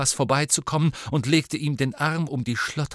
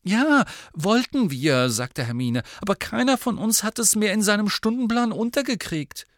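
put-on higher girl's voice; eager young girl, pitched up; Hermione; 11 semitones higher, 3 dB louder than usual. A narrator's reading. Hermione's line, voiced high.

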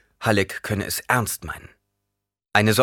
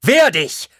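much higher, louder battle cry; fencing master shouting a challenge; shouted command; 12 semitones higher, 10 dB louder than usual. Acted. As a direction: yell it as he charges. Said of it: "rief er".

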